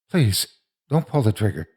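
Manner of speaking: plain reading voice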